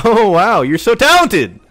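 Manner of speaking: mock the player who beat you; sarcastic praise. Real, not acted. Mocking the enemy who killed him.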